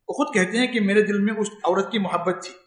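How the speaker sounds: ordinary talk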